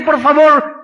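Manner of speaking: loud, high, desperate shouted pleading; a man clinging on and shouting for a blessing; desperate plea. Acted. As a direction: beg with everything you have, crying out. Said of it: "se agarra de Cristo desesperadamente".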